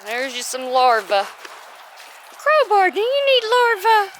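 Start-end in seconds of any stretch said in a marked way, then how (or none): 2.3-4.1 high, louder coaxing call; pet talk. Real, not acted. Offering larvae to the hen Crowbar, high call. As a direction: coax the hen in a squeaky voice.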